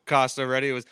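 usual speaking voice

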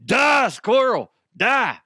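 high, loud, drawn-out hollering; play-acted wild yell; mock maniacal yell. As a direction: holler it like a crazed driver, hammed up. Mock gunning for a squirrel.

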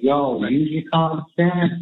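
put-on folksy Southern accent. Mimicking the prosecutor to the jury, folksy Southern accent. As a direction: lay on the Southern charm for the jury. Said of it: "all folksy and sweet and Southern".

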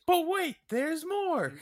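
high, loud TV pitchman shout; Billy Mays. Retold or imitated. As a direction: yell it like an infomercial pitchman. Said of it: "because Billy Mays, he always said".

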